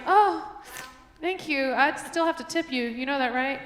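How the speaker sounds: sassy fake gratitude; sarcastic sweetness. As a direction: thank her with a smile and a sting. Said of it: "I was like".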